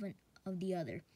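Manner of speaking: plain reading voice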